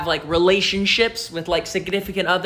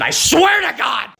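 mock outburst; 6 semitones higher than usual. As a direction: blow up all at once. Clingy rant peaks.

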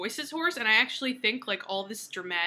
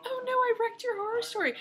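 high mock girlish apology; mock Claire; 12 semitones higher, 3 dB louder than usual. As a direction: squeak it like a flustered girl. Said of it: "and she's like".

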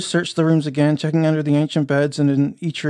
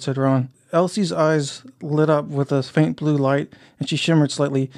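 usual speaking voice

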